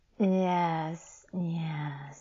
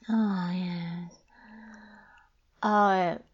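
ordinary talk, nothing marked

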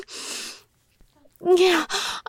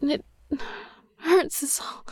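usual speaking voice

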